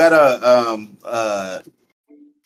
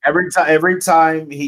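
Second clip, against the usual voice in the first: ordinary talk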